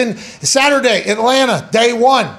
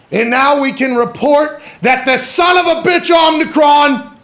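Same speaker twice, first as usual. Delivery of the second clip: hype announcer; 6 semitones higher than usual. Acted. News-style hype announcement.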